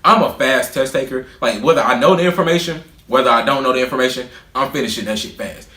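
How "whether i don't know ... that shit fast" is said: ordinary talk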